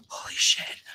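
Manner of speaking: hushed, whispered mock awe; under-the-breath whispered exclamation; retold whisper. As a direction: whisper it as if watching the sky. Retold awe while watching the sky.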